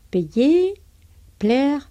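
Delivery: payer y plaire más agudos y fuertes, como ejemplo; French verbs. Shown as a demonstration. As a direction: lanza payer y plaire claros en francés. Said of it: "Et vendre". (ejemplos franceses marcados)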